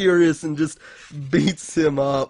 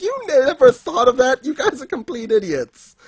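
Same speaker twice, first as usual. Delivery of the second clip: higher-pitched acted scolding; mock exasperation; 10 semitones higher than usual. Voicing a scolding of the clueless gang, higher mock exasperation. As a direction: scold them in mock disbelief.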